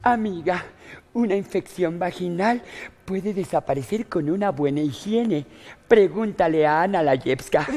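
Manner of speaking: sugary commercial pitch to the camera; ad read. Acted. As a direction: sell the cream like a TV spot, slowing at the end. Betty records the commercial. (lectura de anuncio comercial)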